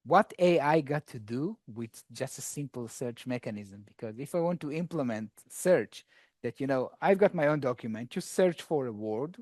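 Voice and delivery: ordinary talk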